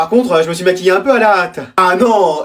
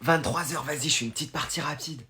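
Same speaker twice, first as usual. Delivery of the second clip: lower, much softer murmur; hushed; 7 semitones lower, 16 dB quieter than usual. Acted. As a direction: sneak in a game at night. Late-night secret gaming.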